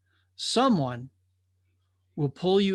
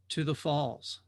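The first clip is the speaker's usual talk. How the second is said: put-on solemn old watcher; 2 semitones lower, 6 dB quieter, slower than usual. Watcher answers the young drifter.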